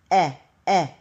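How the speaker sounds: whispered "Eh, eh" short e sound. Shown as a demonstration. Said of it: "I would use my sounds".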